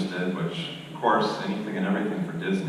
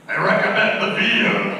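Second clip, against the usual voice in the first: loud, high, drawn-out pirate shout; yelled pirate voice; 7 dB louder than usual. Shown as a demonstration. Dad on the Pirates ride past the restaurant, yelling in pirate voice. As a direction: bellow it across the water like a pirate. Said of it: "I always like to yell out".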